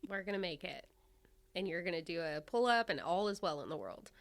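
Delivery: normal talking voice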